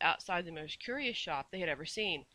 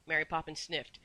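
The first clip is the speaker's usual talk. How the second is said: ordinary delivery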